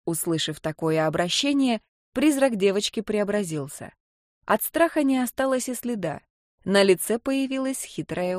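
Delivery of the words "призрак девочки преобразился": ordinary delivery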